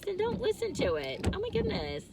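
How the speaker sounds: high-pitched mock indignation; playful exasperation. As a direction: squeak it out in mock outrage. Playfully telling off critics.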